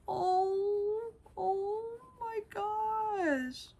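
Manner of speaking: high, slow, drawn-out oh my gosh; breathless gasp of delight. Real, not acted. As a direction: melt at how cute it is. Gasping delight at the toy.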